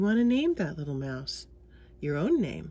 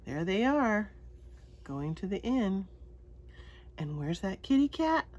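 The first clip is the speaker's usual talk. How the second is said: usual speaking voice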